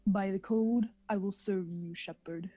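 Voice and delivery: lightly voiced solemn alien matron; a hint of a grave, measured vow; mock-serious Samara voice, only slightly put on; Samara impression. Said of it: "Very well done".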